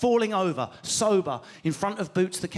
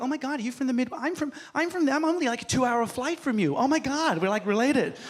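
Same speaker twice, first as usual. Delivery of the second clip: mock gushing American accent, pitched up; excited American; 4 semitones higher than usual. Comic mimicking gushing American tourist, excited American accent. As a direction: gush like an overfriendly American tourist. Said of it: "The Americans will be like".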